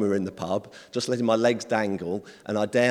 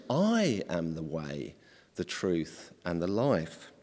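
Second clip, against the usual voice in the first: slow, hushed and lower; solemn quote; 4 semitones lower, 5 dB quieter, slower than usual. Retold or imitated. Preacher quoting Jesus to the disciples, slow and hushed. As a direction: let each phrase settle, softly and gravely. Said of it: "that's when Jesus says".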